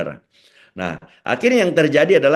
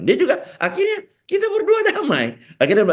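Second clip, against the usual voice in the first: laughing. Real, not acted. Laughs recalling reconciliation.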